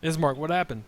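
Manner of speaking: high, loud urgent question; alarmed. Real, not acted. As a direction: demand it in alarm.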